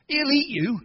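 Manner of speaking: high, mocking warning; taunting voice. Doubter warning the young hero, mocking. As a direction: sneer it like a doubter.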